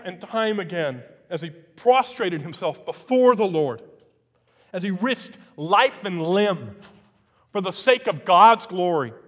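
full-force preaching build. Acted. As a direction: drive it home from the pulpit. Preaching climax on Elijah.

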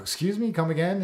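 high-pitched, questioning double take; taken aback. Retold or imitated. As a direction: ask it as a startled mock-polite query. Taken aback by adoption request.

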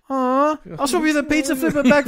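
high, sweet-talking little sister; cajoling kid voice. Retold or imitated. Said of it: "She's like".

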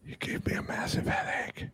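soft whispered complaint. Acted. Player complaining to Trixie about the nightmare guy, whispered. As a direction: keep it hushed, all breath.

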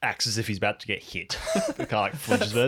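usual speaking voice

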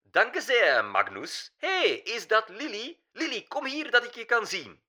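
put-on high, piercing German-accented old diva; German-accented Dutch, pitched high and pointed; theatrical old German star, high and sharp; shrill German accent. A narrator's reading. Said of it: "Een snerpend, puntig accent. Duits".

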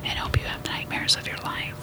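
soft, creepy lullaby whisper; mock bedtime whisper. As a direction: whisper a sinister tuck-in to the listeners. Mock spooky bedtime sign-off.

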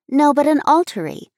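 put-on girl insisting to her mother; persistent girl. A narrator's reading. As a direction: press the point like a stubborn child. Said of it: "persisted Abby".

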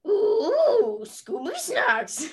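high, slow cartoon dog voice; Scooby-Doo impression. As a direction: beg for the snack like the cartoon dog. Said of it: "now on to Scooby-Doo".

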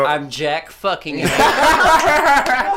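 slow, high laughing boast. Acted. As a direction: stretch it out through laughter.

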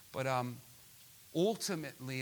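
usual speaking voice

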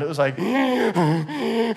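a high, very slow mock wheeze; imitating wheezing. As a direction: drag out a wheezy gasp like a pregnant wife on the stairs. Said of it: "this deep wheezing sound".

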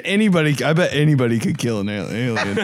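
usual speaking voice

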